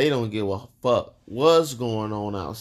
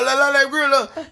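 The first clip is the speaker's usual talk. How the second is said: much higher, louder eager shout; brother-in-law rallying everyone to the grill; 1 hyped grill call; 12 semitones higher, 5 dB louder than usual. Retold or imitated. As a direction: call it out like a party cheer.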